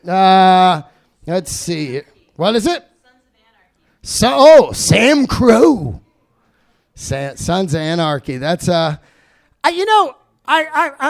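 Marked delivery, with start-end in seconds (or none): none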